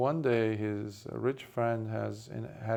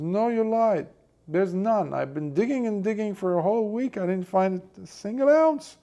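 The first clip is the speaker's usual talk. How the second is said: loud, much higher, indignant ranting; angry outburst; 10 semitones higher, 6 dB louder than usual. Acted. Poor friend ranting at the rich friend about no gold, furious. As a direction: spit out betrayal, voice climbing.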